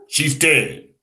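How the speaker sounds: ordinary talk, nothing marked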